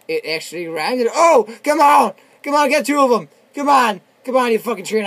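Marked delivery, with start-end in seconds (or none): 1.1-5.1 high, loud pleading yells; urgent shouting. Real, not acted. Gamer urging the train to hit both enemies, urgent shouting. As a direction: holler at it to hurry up.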